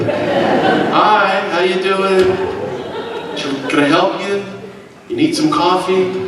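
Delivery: high, cheery, clueless tourist voice; a bright, naive American greeting to armed men; dumb American. Retold or imitated. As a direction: play the friendly oblivious gringo offering coffee. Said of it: "play the dumb American".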